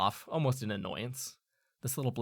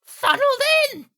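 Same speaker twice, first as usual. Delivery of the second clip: talking bird; 13 dB louder than usual. Acted. Bird answering the player, shrill creature screech. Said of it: "turns its head and looks at you".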